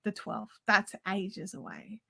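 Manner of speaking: low, slow, deadpan sarcasm; sarcastic. Retold or imitated. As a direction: let it drop flat and slow in disbelief. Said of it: "And I was just like".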